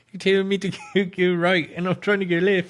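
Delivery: high-pitched mimicry of an irate driver; mock confused driver. Co-host mimicking the confused Uber driver, mock complaint. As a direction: put on a high, silly complaining voice.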